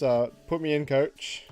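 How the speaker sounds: ordinary talk, nothing marked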